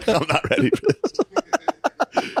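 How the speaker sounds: high, slow, laugh-broken plea. Real, not acted. Co-host reacting to a crude joke, high laughing plea. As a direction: squeak it out through helpless laughter.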